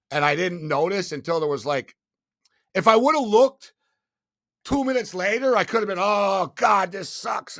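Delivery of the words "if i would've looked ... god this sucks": louder, higher panicked outcry; imagined panic. Retold or imitated.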